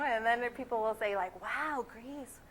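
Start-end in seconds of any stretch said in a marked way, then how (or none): 1.4-2.4 put-on fascination with swooping pitch; mock gushing amazement. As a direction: gush as an enchanted stranger would. People gushing over father's origin.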